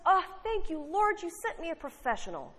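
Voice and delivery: high, lightly voiced grateful old lady. Preacher telling a joke to the congregation, high grateful lady voice. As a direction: gush your thanks up high like a sweet churchgoing lady.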